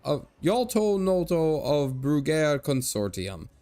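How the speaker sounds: put-on jolly old Irish merchant showing off; Irish leprechaun merchant. Old merchant boasting to strangers in a hammed-up Irish brogue.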